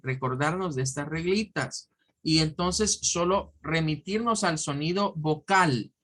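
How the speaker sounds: ordinary delivery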